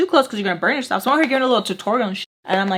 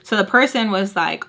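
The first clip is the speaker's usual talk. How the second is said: ordinary talk, nothing marked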